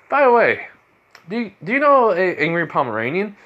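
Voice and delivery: sudden excited question. Acted. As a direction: blurt it out with sudden glee.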